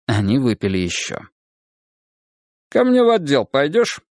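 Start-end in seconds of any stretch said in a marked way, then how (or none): 2.7-4.0 casual, high-pitched question; easy, offhand inquiry with lifted pitch; casual offer. A narrator's reading. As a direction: toss out the offer breezily. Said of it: "запросто осведомился Тимоха".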